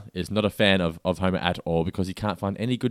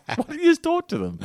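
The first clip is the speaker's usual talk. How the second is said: incredulous squeak. Retold or imitated. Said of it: "I'm like".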